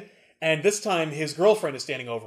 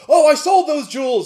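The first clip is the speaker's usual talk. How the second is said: nervous broker; 9 semitones higher, 9 dB louder than usual. Retold or imitated. Pawnbroker answering the voice.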